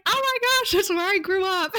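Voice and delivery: high, gushing with delight; excited squeal. Retold or imitated. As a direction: squeal it out with a laugh. Retelling excited moment seeing hometown.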